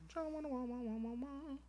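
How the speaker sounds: high, silly sing-song counting; high mock voice. Teasing his friend in a high, silly squeaky voice. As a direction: tease in a squeaky voice.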